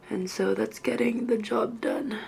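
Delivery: a breathy worn-out aside; tired whisper. Real, not acted. Half-asleep student whispering to viewers at 2am. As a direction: whisper it, barely awake.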